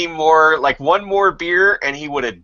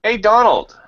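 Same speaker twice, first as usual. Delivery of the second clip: bright, raised call up high; calling out with fake warmth; mock friendly call; 10 semitones higher, 5 dB louder, slower than usual. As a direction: hail him like a cheery host. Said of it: "they'd be like".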